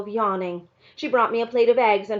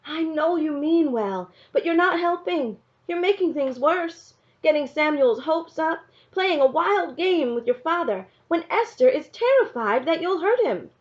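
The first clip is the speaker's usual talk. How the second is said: a mother voiced higher, pleading; mother's pleading voice; 8 semitones higher than usual. A narrator's reading. Mother begging her daughter to stop trying to wake her father. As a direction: plead gently but urgently, like a worried mother.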